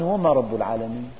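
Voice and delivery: low, quiet, dismissive question; Pharaoh's disdain. Acted. Pharaoh mocking Moses's Lord, low and quiet scorn. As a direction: sneer it low as if no lord but you exists. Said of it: "يعني أنا الإله".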